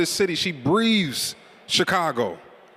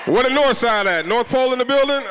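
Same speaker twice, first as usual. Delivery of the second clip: crowd call-out; 6 semitones higher than usual. Real, not acted. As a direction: shout across the room for a cheer. Calling out to crowd sections.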